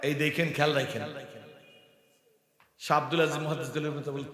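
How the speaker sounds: normal talking voice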